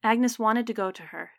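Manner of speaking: ordinary delivery, nothing marked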